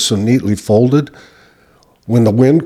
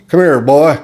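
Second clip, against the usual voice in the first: put-on loud, higher barked "Come here, boy."; sheriff's command; 5 semitones higher, 6 dB louder than usual. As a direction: call the kid over like a Southern lawman. Sheriff calling him over.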